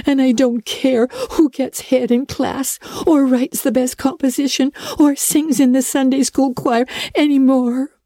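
put-on high, pleading girl's voice in despair; a young girl hiding her face, pleading. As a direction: beg the guardian to leave her alone, near tears.

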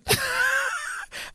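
a giddy shriek of laughter; high squealing laugh. Real, not acted. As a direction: let out a high fan squeal. Recalling meeting a star.

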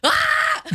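high mock scream of frustration; exasperated scream. Retelling a painting squabble with her partner, exasperated mock scream. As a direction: let out a short, high "Ah!" at a stubborn partner.

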